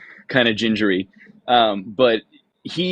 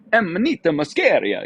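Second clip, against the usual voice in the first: heavy Italian accent; 5 semitones higher than usual. Shown as a demonstration. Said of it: "But if I went".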